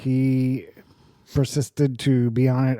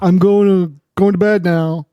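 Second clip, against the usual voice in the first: imitating son; 6 semitones higher, 8 dB louder, slower than usual. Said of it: "woke me up saying".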